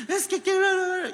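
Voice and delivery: put-on a child crying too hard to be understood, high and loud. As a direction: blubber it like a kid mid-tantrum. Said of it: "Y no se le entiende nada".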